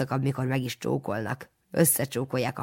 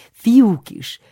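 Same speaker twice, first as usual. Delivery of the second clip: high, loud jovial lift; hearty promise; 9 semitones higher, 10 dB louder than usual. A narrator's reading. Jolly priest lures the boy with boys, high and loud. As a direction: dangle it as a treat.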